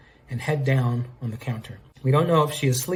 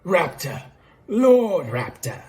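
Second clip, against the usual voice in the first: higher, drawn-out Australian character voice; bad Australian accent; 5 semitones higher, much slower than usual. Lord Raptor corrects how he is addressed, bad Australian accent. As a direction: correct them in a hammed-up Aussie twang. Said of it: "do Australian".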